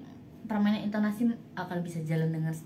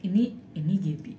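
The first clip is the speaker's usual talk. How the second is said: dark, heavy ad voice; heavy eerie voice; 2 semitones lower, faster than usual. Shown as a demonstration. Demo for an ad brief of a heavy, eerie voice. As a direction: make it sound menacing and heavy. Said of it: "berat ya".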